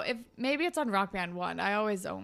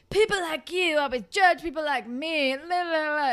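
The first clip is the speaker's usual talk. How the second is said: high, loud imitated complaint; mock annoying whine; 6 semitones higher, 5 dB louder than usual. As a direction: mimic his chip-on-the-shoulder griping. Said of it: "so fucking annoying with this goddamn".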